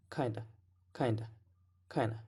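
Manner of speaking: "kinda" said as "kina", no D; dropped D. Shown as a demonstration. Teacher copies the actor who drops it, "kinda" without the D. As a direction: slide from N straight to uh in "kinda". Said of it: "But he drops it".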